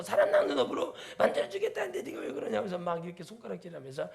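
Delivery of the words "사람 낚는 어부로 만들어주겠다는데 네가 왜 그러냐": much higher, louder mock rebuke with a laugh; God's scolding voice. Lord through the pastor scolding the reluctant preacher, high laughing mock rebuke. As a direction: play the Lord teasingly scolding, pointing a finger. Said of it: "심히 부담스럽게 저를 보면서".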